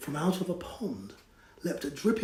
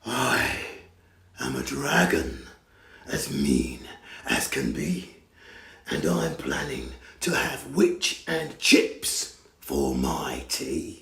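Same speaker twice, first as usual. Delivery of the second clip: scary dragon. Acted. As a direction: growl it like a hungry dragon. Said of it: "a roar that was scary and loud".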